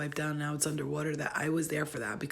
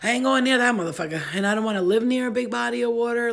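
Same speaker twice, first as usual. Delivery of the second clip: loud, fast, higher-pitched refusal; emphatic refusal; 4 semitones higher, 8 dB louder, faster than usual. Retold or imitated. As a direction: blurt out a loud, firm no.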